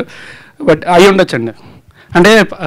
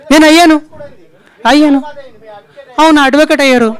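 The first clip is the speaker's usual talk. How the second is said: high, raised-voice insistence; loud insistent retort; 9 semitones higher, 4 dB louder than usual. Real, not acted. Accused man answering a pressing reporter, loudly insistent. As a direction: call it back over the room, high and loud.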